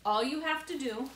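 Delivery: ordinary delivery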